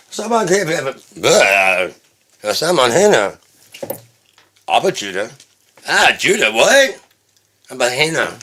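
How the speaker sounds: high-pitched nonsense babble; acted gibberish with squawked outbursts; baby-talk gibberish. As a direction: babble made-up words high, in short bursts. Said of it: "you're still doing this fucking baby talk shit".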